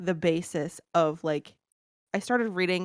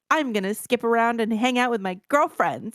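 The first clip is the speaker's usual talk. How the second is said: higher, singsong mocking lilt; mock carefree schoolgirl; 5 semitones higher than usual. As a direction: parody a giddy girl planning fun. Mocks book's carefree schoolgirl tone.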